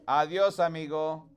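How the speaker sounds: teacher modelling "Adiós, amigo"; Spanish model phrase. Shown as a demonstration. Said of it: "repeat after me".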